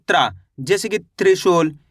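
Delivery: ordinary talk, nothing marked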